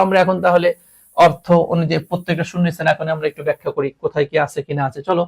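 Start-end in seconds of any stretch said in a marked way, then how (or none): none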